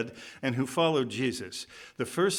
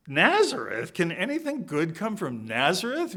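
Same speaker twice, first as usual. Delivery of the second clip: raised, incredulous mock disdain; incredulous scorn; 5 semitones higher, 3 dB louder than usual. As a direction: scoff at the very name, voice lifted. Acting out Nathanael's scorn at Nazareth.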